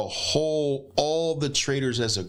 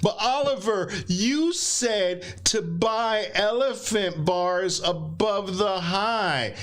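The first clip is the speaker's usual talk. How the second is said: higher, slow mock student complaint; whiny student; 6 semitones higher, slower than usual. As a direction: imitate a confused trader objecting. Mocking a confused student.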